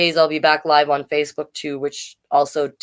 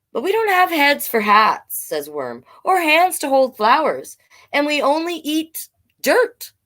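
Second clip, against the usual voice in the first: higher worm voice, protesting; worried worm; 10 semitones higher than usual. Acted. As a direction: point out the problem plaintively.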